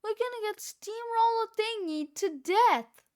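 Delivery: high, loud panic; panicked scream. A narrator's reading. Fluffy stuck under a steamroller, screaming. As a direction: scream in doom. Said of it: "screamed Fluffy".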